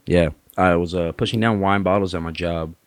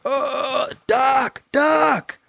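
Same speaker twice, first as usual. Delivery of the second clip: much higher, yelled doc doc; bleeding patient shouting for the doctor; panicked call; much slower than usual. Retold or imitated. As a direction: cry out for the doctor. Calling doctor while bleeding.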